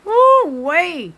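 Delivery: high, loud exclaim; woo-wee holler. Real, not acted. Reacting to the episode with a loud woo-wee. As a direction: let out a hoot.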